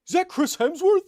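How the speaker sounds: high, far mock voice of an amazed stranger; imitating passerby. Mocking the passerby who mistook him, high squeaky voice. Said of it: "and he's like".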